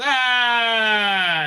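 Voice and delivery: long, high mock scream, falling away. Acting out the brains-falling-out scare, mock scream. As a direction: imitate a horror scream, held long. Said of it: "It goes".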